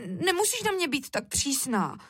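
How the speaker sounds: put-on high little-girl protest; sulky child. A narrator's reading. Šárka objects to mother's strictness, sulky. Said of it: "zamračila se Šárka".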